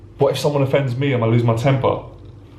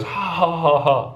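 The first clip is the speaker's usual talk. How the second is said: mock laugh; 5 semitones higher, slower than usual. Crowd at party mocking him.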